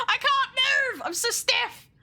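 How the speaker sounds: high-pitched comic wail; exaggerated, squealed complaint; mock distress. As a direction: cry out in playful helplessness. Comic lament about stiff model.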